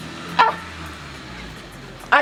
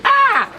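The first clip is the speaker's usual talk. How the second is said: sudden high shocked cry; shocked shriek; 3 dB louder than usual. Acted. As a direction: let out a sharp high yelp of shock. Shocked reaction in skit.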